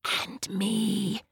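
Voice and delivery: drawn-out snaky hiss; sly snake voice, stretched out. A narrator's reading. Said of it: "hissed Snake".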